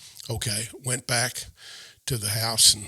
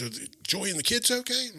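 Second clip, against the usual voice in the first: much higher, concerned voice; retold worried question; 12 semitones higher than usual. Sister-in-law asks crying visitor about family, worried. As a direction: quote a worried woman checking on family.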